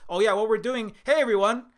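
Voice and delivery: high, loud, sing-song call to the world; hammed-up welcome, high and loud; mock cheerful invitation. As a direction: chirp it out like a naive host. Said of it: "Hawaii didn't come out and say".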